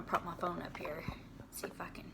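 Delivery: whispering under her breath; whispered aside. Real, not acted. Setting up her phone, whispering to herself. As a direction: whisper to herself while fiddling. Muttering while propping up phone.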